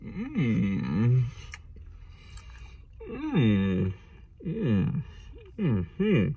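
muffled hums while eating; savoring food mid-chew; chewing with mouth closed. Real, not acted. Seen 0: Bite at -5; closed mouth chewing, pastry held, until 4.5.

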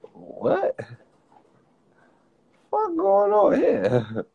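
high-pitched shocked disbelief; shocked what. Real, not acted. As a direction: squeal it out in disbelief. Surprised reaction on stream.

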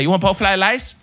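imitating a heavy Chinese accent, r's turned to l's. Chinese restaurant worker takes the order, in a mock Chinese accent. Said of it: "his accent was straight out of a stereotype".

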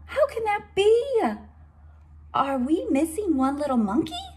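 high, anxious disbelief; a mom who can't believe her count; puzzled Mama. Acted. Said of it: "says Mama".

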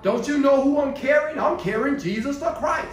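preacher mocking parents demanding a room, indignant holler. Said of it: "didn't holler about".

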